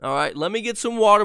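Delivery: ordinary talk, nothing marked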